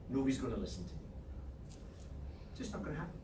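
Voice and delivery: low, soft, resigned self-pity; mock defeatism. Coach acts out his excuse story, defeated and resigned. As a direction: let it sink as if already beaten.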